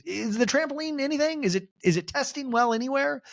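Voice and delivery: high-pitched eager neediness; Angie K impression. Castmate checking whether her insult works for viewers, mock needy. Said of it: "Angie K's just like".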